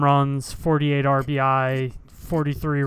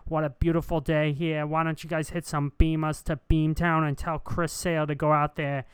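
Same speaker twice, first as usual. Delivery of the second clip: Boston sports radio host. Pretend. Live from Fenway, a mild Boston broadcaster voice. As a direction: hype it up like a Boston call-in host. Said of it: "that's a really good impression".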